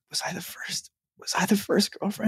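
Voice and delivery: unvoiced, anxious whisper; whispered worry. Ex-girlfriend wonders if she was first, nervous whisper. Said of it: "they'll be like".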